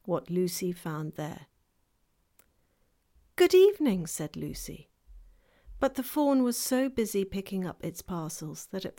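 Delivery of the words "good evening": put-on high, bright girl's greeting; a young girl greeting a stranger. Lucy greets the fawn.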